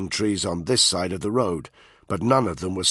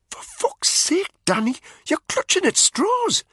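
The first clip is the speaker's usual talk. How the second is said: much higher, slow and exasperated; frustrated friend begging him to see sense; Spud, exasperated; 3 dB louder than usual. A narrator's reading. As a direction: throw up your hands at him.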